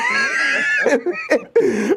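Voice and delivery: high-pitched sustained laugh; helpless high laugh; real laughter. Real, not acted. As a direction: crack up, pitch shooting way up. Cracks up at dated band reference.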